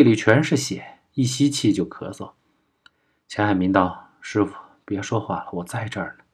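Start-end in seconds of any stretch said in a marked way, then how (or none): 4.2-6.2 very soft, tender reassurance; hushed comforting. A narrator's reading. Pupil at his dying master's side, hushed and comforting.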